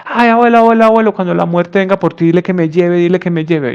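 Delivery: put-on a boy begging grandpa again and again; insistent child pleading. (niño insistiendo, voz aguda que baja)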